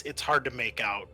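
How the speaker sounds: usual speaking voice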